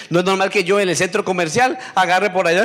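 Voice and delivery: ordinary delivery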